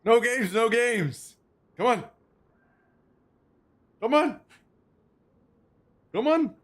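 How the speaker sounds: high, squeaky dog voice, no games; voicing the dog. Streamer voices her dog begging for a fry, high and squeaky. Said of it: "He says".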